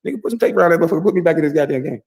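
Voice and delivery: high, fast fired-up yell; coach hyping him up. Retold or imitated. Teammate voicing the hyped coach, high and fast. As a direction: act out the coach getting him back in the game. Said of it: "He was getting us up".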